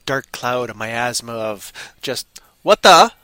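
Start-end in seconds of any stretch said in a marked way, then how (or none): 2.6-3.1 loud, sudden mock exclamation; loud mock what the; startled outburst. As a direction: blurt it out startled. Mock reaction in dark cloud.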